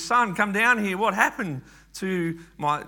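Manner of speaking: retold father calling his son down. Father summons his son after finding the idols smashed, calling out. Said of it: "he's called out to his son".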